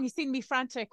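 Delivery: ordinary talk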